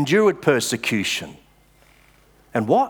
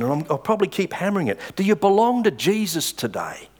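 usual speaking voice

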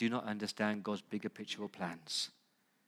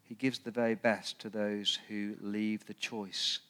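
poem recitation. Shown as a demonstration. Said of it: "It's a poem by".

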